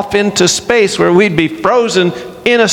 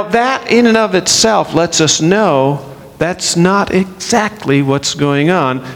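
ordinary delivery

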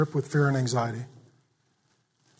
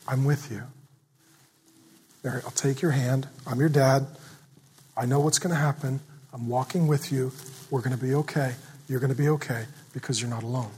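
gentle reassurance; 3 semitones lower, 4 dB quieter than usual. Retold or imitated. God comforting Mary gently.

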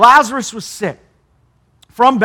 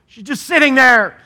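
higher, louder exasperated outburst on "Lord"; exasperated sister complaining and pleading for help; boiling over; 4 semitones higher, 4 dB louder than usual. Acted. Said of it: "She finally boils over".

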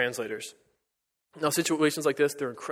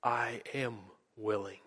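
slow, soft and tender; 3 semitones lower, 8 dB quieter, slower than usual. Acted. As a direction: let the words settle gently, softer. Jesus's words repeated, softly reflective.

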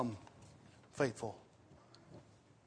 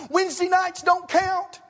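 gleeful punchline; 12 semitones higher, 5 dB louder than usual. Retold or imitated. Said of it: "The guy says".

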